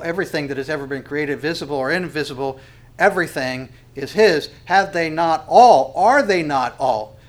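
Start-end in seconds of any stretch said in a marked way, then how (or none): 4.7-7.2 all punched loud and stretched, both times; stress on all. Shown as a demonstration. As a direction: ring out the word all in each phrase. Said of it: "I want to emphasize all".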